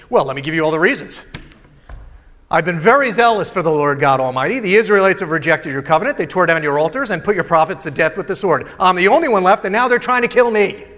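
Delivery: loud, fast, self-justifying rant; defensive complaint. Acted. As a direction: rattle off every excuse loudly. Elijah's defensive reply to God.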